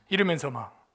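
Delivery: ordinary talk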